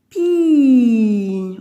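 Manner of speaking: Pi said loud and drawn; syllable punched. Shown as a demonstration. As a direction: give Pi a loud clear push. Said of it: "On entend bien là". (dire Pi fort et net)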